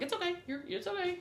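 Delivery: high sing-song reassurance; reassuring the child. Retold or imitated. Comforting the niece so she won't cry, high soothing voice. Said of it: "I was like".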